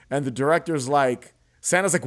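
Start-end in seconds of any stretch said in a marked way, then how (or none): none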